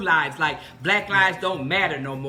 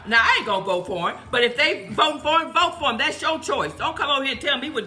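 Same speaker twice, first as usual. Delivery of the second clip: defiant outburst; 7 semitones higher than usual. Real, not acted. Defiant rant about yard signs.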